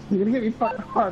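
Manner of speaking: normal talking voice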